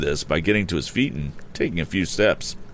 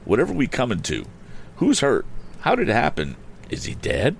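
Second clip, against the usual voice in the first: flustered housekeeper, pitched up; alarmed questioning; 7 semitones higher than usual. A narrator's reading. Housekeeper asks who was hurt, mildly alarmed and high. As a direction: fire off worried questions in a higher voice. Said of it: "cried Missus Baggert".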